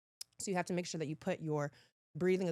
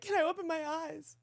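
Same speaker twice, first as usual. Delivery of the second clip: high, small-person plea; helpless pleading question; 11 semitones higher than usual. Acted. Contestant asking staff with eyes shut, high mock plea.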